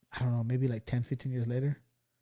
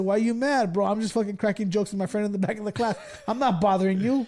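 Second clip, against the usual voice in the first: higher, louder retold protest; indignant protest; 6 semitones higher, 3 dB louder than usual. Student defends joking in back of class, indignant. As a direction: plead your innocence, imitating an indignant student.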